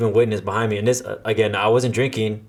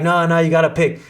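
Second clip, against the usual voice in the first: higher, louder teasing insistence; mock insisting drunk; 7 semitones higher, 4 dB louder than usual. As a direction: play the pushy party-goers. Acquaintances insisting playfully.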